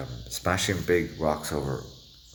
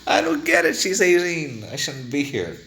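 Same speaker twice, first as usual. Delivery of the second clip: much higher, louder put-on voice of protest; exasperated other voice; 13 semitones higher, 7 dB louder than usual. Voice in Carl's head objecting to the affair, high loud protest. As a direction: pitch it way up in mock alarm.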